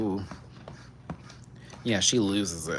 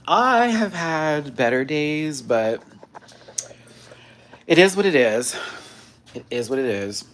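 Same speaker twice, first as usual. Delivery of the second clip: usual speaking voice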